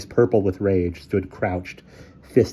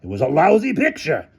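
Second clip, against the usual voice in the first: character voice; 9 semitones higher, 3 dB louder than usual. A narrator's reading. Hamilton retorts.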